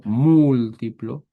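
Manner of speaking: múltiplo with a long, stressed MÚL; múltiplo very slowly, leaning hard on the opening syllable. Shown as a demonstration. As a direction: stretch the first U of múltiplo. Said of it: "acento en la U".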